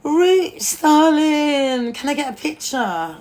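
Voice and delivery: high, loud mock fan calling out. Imitating tourist fans asking for a photo, high calling voice. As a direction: call out like an excited tourist fan. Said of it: "they're like".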